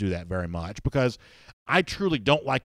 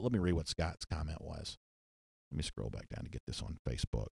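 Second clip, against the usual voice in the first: low, soft mumble to himself; muttered aside; 5 semitones lower, 14 dB quieter than usual. Real, not acted. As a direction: talk under your breath while searching the screen. Murmured aside while scrolling Facebook.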